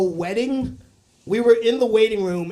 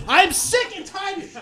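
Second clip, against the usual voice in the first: abrupt high mock outburst; mom resuming yell; 10 semitones higher than usual. Mom off the phone, back to scolding, high mock yell. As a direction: snap back into the imitated high rant. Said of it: "Anyway, what I was saying".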